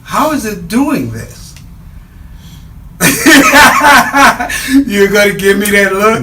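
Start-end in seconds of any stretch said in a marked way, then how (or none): 1.4-4.8 loud laugh. Real, not acted. Big laugh at the look.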